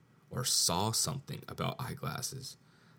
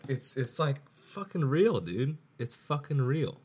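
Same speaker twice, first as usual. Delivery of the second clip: higher and louder, worked up; genuinely fired-up realization; excited disbelief; 7 semitones higher, 5 dB louder than usual. Real, not acted. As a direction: blurt it out, amazed it's true. Amazed that the ad worked.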